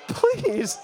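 high comic plea; mock pleading. Preacher begging God for a healing, comically desperate. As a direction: beg in a high, exaggerated voice.

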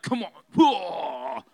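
hammed-up muscleman roar; yoked fighter. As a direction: growl it out like a mock cage fighter. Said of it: "He's not coming out, like, yoked, all like".

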